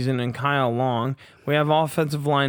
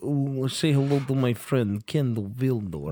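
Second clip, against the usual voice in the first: put-on Russian accent. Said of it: "sounds Russian".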